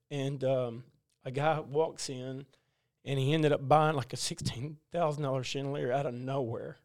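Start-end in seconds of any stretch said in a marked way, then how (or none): none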